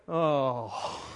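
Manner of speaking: low, sinking groan of disappointment; let-down kids. Retold or imitated. Kids learning it is an adult party, crestfallen. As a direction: deflate on a falling sigh.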